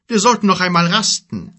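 höhere, laute Riesenstimme; Riese Gork. A narrator's reading. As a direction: als großer Riese lautstark vorschlagen. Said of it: "schlug er vor". (Gork schlägt den Gefährten eine Rast vor, laut)

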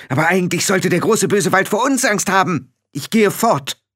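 a hint of a rebellious young witch; Tiffany's voice. Tiffany telling Frau Grad she is leaving, mildly defiant.

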